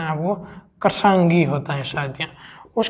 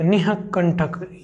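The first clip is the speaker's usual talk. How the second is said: ordinary talk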